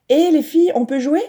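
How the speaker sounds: high, loud call; a girl calling friends to play. A narrator's reading. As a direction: hail them across the yard. Calling out to the girls.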